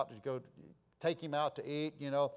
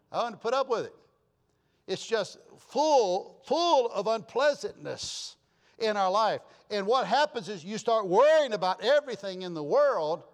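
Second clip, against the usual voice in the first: loud, high, drawn-out key words; forceful preaching; 5 semitones higher, 7 dB louder than usual. Real, not acted. Preacher warning the congregation about worrying, impassioned. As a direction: preach it hard, leaning on the stretched words.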